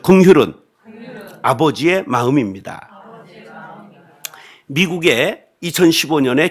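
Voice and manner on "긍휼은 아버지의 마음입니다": loud, emphatic line for the congregation to repeat; call-and-response line. Shown as a demonstration. Preacher leading the congregation to repeat, loudly. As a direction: proclaim it so the congregation can echo. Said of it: "따라하시죠".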